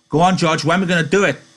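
high, loud, impatient question; eager Lennie. A narrator's reading. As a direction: pester your friend excitedly. Reader voices Lenny urging George.

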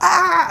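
high startled ah; panicked yelp. Retold or imitated. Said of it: "I'm just freaking out".

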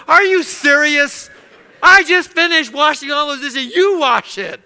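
loud, high, indignant outburst; acted fed-up dishwasher's protest; grumbling. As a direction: blow up in hammed-up exasperation, drawing out the first words. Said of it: "That would be grumbling".

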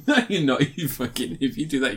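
ordinary talk

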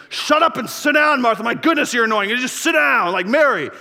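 high, loud, exasperated telling-off; scolding. Acted. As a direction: act out a harsh scolding at full voice. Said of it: "Jesus isn't scolding her here".

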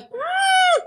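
very high closed-mouth squeal; stifled squeal. Retold or imitated. Retelling the tick crawling down her face, stifled squeal. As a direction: squeal through shut lips, acting out panic.

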